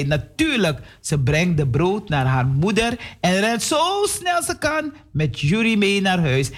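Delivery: ordinary delivery, nothing marked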